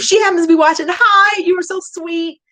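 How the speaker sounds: loud, quick, high greeting; excited hello. Real, not acted. Streamer greeting a woman she met, excited. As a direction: call out hi eagerly.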